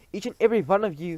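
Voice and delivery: loud, fast, high pronouncement; thundered. Acted. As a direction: boom it out. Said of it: "thundered the Grand High Witch".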